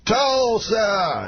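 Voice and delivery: high, drawn-out mimicked stage call; Bill Cosby. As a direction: call it to a crowd in impression. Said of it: "walks out, and he goes".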